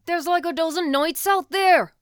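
very loud, high-pitched yell; exasperated shout. Acted. Gethin shouting back at Jasper mid-fight, exasperated yell.

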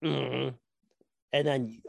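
ordinary talk, nothing marked

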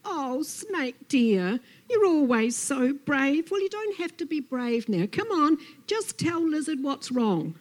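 high, loud, overdone sympathy; cooing Lizard. Acted. Lizard pats Snake and coaxes, patronisingly sympathetic. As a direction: coo and fuss, drawing out the first words.

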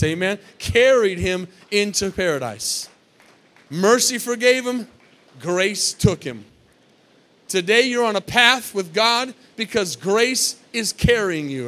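loud, slow, punched preaching; loud pulpit preaching with hammered words; preaching at full force. Acted. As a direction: drive each phrase home to the congregation. Preaching climax with loud bursts.

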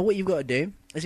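usual speaking voice

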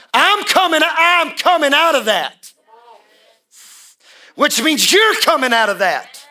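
high, loud testimony shouted with a laugh; full-force preaching. Acted. Pastor testifying to the church he's coming out, loud. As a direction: testify it from the gut.

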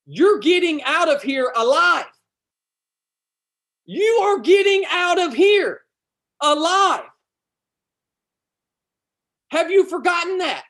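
high, loud preaching, punched out; fired up. Real, not acted. Preacher reminding listeners of their promise, fired up. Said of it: "I'm getting a little fired up".

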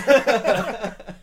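sudden high laugh; loud burst of laughter. Real, not acted. Laughing at bodyguard joke.

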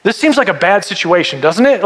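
ordinary delivery

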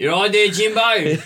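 put-on failed Aussie accent that slips into Cockney, laughing; bad Australian accent. Ian mocking a council officer, slipping Cockney accent. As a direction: try an Aussie bloke and drift Cockney. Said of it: "Ian doesn't do accents ... came out as Cockney".

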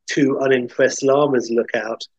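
plain reading voice

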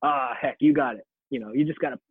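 light imitation of a heavy Southern accent; Sean's heavy Southern accent. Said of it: "with his very heavy Southern accent would go".

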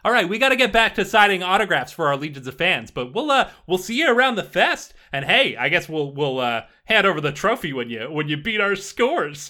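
high, loud showboating rocker; brash and high, playing to the fans; cocky festival band star. Band star brushing off a challenger, cocky and loud.

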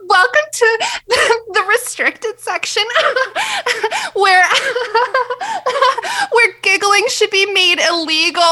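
high giggly intro voice; giggling through the intro. Pretend. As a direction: giggle through every word. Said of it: "Is that a giggle?".

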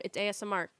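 ordinary talk

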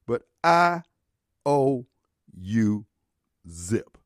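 a deliberate, falling final verdict; slow emphatic punchline. Real, not acted. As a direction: drop "But I owe you zip" one word at a time.

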